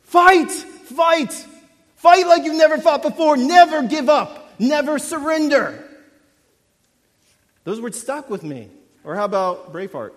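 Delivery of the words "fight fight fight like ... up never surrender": much higher, loud battle cry, slowing on never give up; loud, high rallying yell to fight on; rallying shout. Retold or imitated. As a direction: roar it to an army before the charge. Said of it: "and he says".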